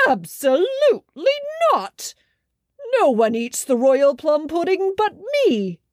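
king refusing to share his pudding; indignant refusal. A narrator's reading. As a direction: slam the door on them as king. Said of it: "said the king".

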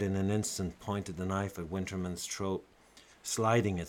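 plain reading voice

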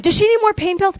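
high, urgent question; worried. Retold or imitated. Wife pressing husband about the dog, urgent. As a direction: press him anxiously. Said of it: "worried wife quoted".

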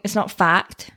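ordinary delivery

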